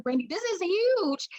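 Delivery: giddy high-pitched delight; thrilled squeal. Retold or imitated. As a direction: squeal the good news to a friend. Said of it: "I was like".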